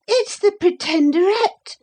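put-on very high girlish cry. A narrator's reading. Lucy recognising the rider, high alarmed cry. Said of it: "cried Lucy".

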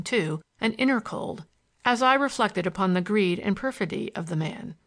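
ordinary delivery, nothing marked

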